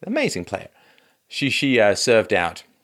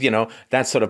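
ordinary delivery

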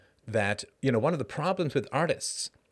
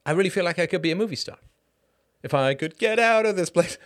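put-on Australian accent; 7 semitones higher, 5 dB louder than usual. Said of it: "I've completely lost the accent".

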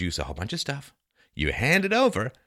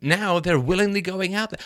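ordinary delivery

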